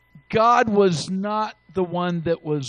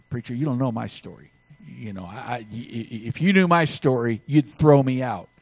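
doubtful churchgoer; 8 semitones lower than usual. Retold or imitated. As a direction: mumble it like someone ashamed of their past. Doubting churchgoer voiced by preacher.

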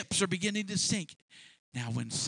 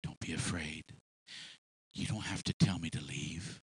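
gentle reassurance; 7 semitones lower than usual. Acted. Jesus calming kneeling Simon, gentle reassurance. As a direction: soothe a frightened man. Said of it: "And Jesus said unto Simon".

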